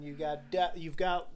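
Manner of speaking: ordinary talk, nothing marked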